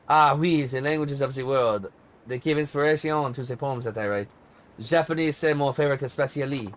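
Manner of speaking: a hint of a hammed-up French accent on the diva's lines. Pop diva shows off her languages, in a light mock French accent. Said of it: "What do you think of my French accent?".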